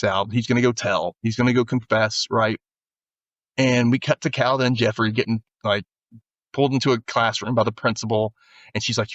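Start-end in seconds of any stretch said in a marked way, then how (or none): none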